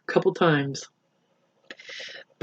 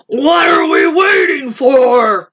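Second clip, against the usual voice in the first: loud, slow, higher lion cry; eager lion cry; 5 semitones higher, 4 dB louder, slower than usual. Acted. Greedy lion eager to go eat the rabbits, loud cry. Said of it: "cried the lion".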